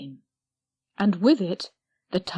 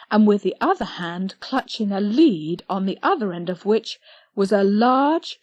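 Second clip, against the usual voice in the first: ordinary delivery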